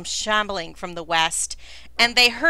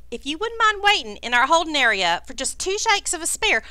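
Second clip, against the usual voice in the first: flustered Southern politeness, pitch swooping up on key words; mannered, lilting Southern character voice; embarrassed, genteel lizard host; 4 semitones higher than usual. Acted. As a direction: excuse yourself like a folksy Southern host. Crackdust speaks, embarrassed, to the party.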